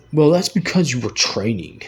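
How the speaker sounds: lightly put-on boss voice; a hint of mock condescension; mocking supervisors. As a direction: mimic a dismissive excuse. Mimicking supervisors' excuse.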